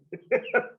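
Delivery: short high giggle; real amused giggle; high laugh burst. Real, not acted. Laughing at tea snort story.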